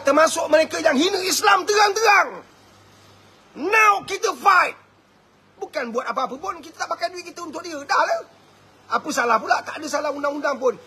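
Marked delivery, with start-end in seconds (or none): 0.0-4.7 rallying shout. Real, not acted. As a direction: rouse the crowd to fight. Rallying call to boycott.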